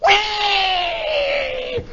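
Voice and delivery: loud, piercing imitated whine; long high wire squeal. Imitating the chute catching the wind, high squeal. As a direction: squeal like a runaway winch wire.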